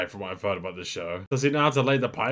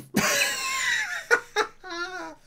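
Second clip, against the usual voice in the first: laughing fit. Real, not acted. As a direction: crack up and can't stop. Can't stop laughing.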